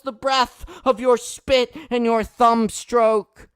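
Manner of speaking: impassioned open-mic poem reading, swelling to shouts; spoken-word poet pleading, building to cries; poetry performance. Acted. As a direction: pour the poem out like a plea, rising and falling.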